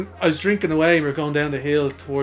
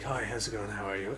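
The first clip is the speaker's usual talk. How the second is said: soft, lower, put-on posh phone voice; 6 semitones lower, 14 dB quieter than usual. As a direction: play the poshest caller you can. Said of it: "put on my poshest voice ever".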